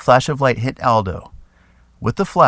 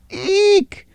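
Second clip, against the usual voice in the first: shrill panicked shriek, far higher and louder; 5 dB louder, much slower than usual. A narrator's reading. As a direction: squeal in terror as the spider lands. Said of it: "as Ula shrieked".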